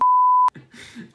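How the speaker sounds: high burst of laughter; cracking up out loud. Real, not acted.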